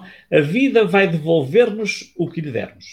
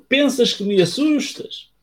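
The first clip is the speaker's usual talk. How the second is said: echo reply; 9 semitones higher than usual. Acted. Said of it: "ouviu o retorno".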